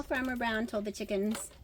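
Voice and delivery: ordinary delivery, nothing marked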